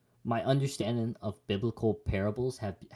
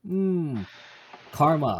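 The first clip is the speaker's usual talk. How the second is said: mock musing; 6 semitones higher, 6 dB louder, much slower than usual. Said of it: "and then you're like".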